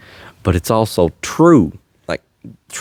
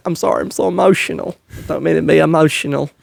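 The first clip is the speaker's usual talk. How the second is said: higher, fast, whiny imitation of a flustered crier; mock apologetic crier; 7 semitones higher, faster than usual. Mocking people who apologize for tearing up, higher and fast. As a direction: mockingly rush through the sorry-I'm-emotional apology. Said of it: "they say".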